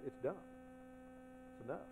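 ordinary delivery

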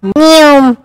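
a shrill squeaky cat meow; high loud meow. Shown as a demonstration. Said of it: "Or more appropriately".

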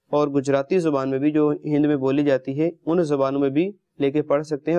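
ordinary delivery

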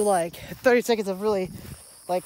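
ordinary delivery

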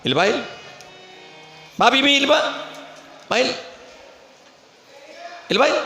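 a hint of an old saint calling her over, rising high; lightly voiced elder beckoning, pitch climbing; old man calling. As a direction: coax her close with high sing-song calls. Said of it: "ಅವ್ರ ಮಾತು ಹಿಂಗೆ ರೀ".

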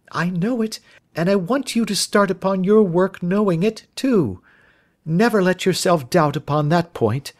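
put-on high, fervent young woman's voice; excited, concerned young lady; earnest Miss Turner. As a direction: plead his innocence with conviction.

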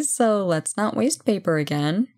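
ordinary talk, nothing marked

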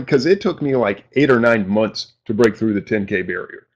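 ordinary talk, nothing marked

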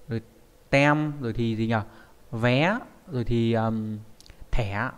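normal talking voice

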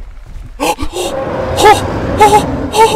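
loud, high startled gasp; sharp gasp. Real, not acted. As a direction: gasp in sudden shock. Sudden fright in game.

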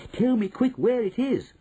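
put-on high, pressing boy's plea; eager cry. A narrator's reading. Boy begging grandmother for the castle's location, eager cry. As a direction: urge her to answer right now. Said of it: "I cried".

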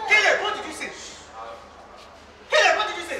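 loud, high challenge to Caleb; angry demand. Acted. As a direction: confront him, outraged. Wife takes offense, confronts Caleb.

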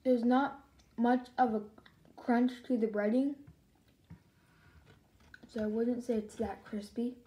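talking between bites; chewing while talking. Real, not acted. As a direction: mouth partly full of food. Seen 0: Bites food at -2; closed, puffed lips while speaking through the stretch.

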